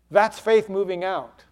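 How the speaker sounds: ordinary delivery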